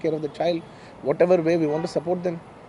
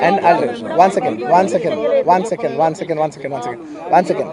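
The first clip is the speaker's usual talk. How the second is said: calling over the crowd; 5 dB louder, faster than usual. Real, not acted. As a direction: raise your voice over a noisy press room. Calling over shouting reporters.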